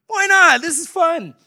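very high, loud burst of glee; delighted agreement. Retold or imitated. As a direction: chirp it out, caught up in the fun.

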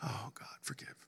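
hushed, breathless whisper. Retold or imitated. Preacher retelling his humbled prayer, whispered. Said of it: "And I went".

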